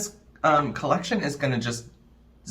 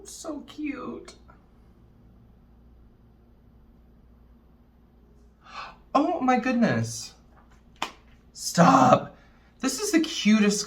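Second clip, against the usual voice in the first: high, squealing delight over cuteness; gushing; 8 semitones higher than usual. Real, not acted. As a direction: squeal it like it's adorable. Gushing over cute palette.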